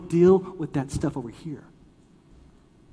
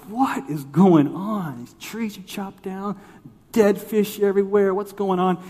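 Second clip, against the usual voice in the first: high, drawn-out disbelief; mock dismay, pitched up; bewildered; 7 semitones higher, 5 dB louder than usual. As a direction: retell a baffled reaction, stretching the opening words. Retold bewildered reaction to dead fish.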